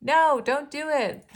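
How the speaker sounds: high, loud, slow no; the ego panicking, don't do it; alarmed ego. Pretend. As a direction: shout the warning as the scared ego. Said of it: "And their ego says".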